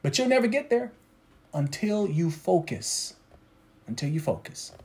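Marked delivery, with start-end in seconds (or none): 1.5-3.1 soft, slow "until you focus"; slow, hushed "until you focus"; quiet emphasis. Acted. 3.9-4.7 soft, low "Until you focus."; quiet low echo of "Until you focus."; hushed echo. Acted. Softer echo of the line.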